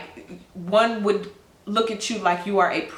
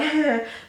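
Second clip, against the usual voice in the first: awkward laugh; 6 semitones higher than usual. Pretend. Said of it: "you still like".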